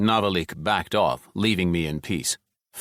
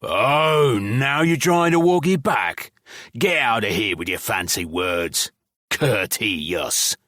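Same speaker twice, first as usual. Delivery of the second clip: higher, sneering mockery, drawn-out Courteous; mocking drawl; 3 semitones higher, slower than usual. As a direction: goblin ridiculing Scythe's polite words, mocking drawl. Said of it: "the goblin drawled mockingly".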